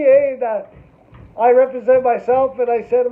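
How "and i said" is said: ordinary talk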